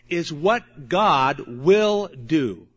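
"God", "will", "do" slowly spaced, each weighted; slow right down to land "God will do"; stress on GOD WILL DO. Real, not acted. Preacher correcting the emphasis, deliberate stress on "God will do". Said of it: "The emphasis".

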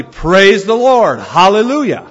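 imitated loud, slow, high proclamation; really loud preacher cry. Friend shouting it in a restaurant, really loud preacher cry. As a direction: boom it out to a whole restaurant. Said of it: "he said really loudly".

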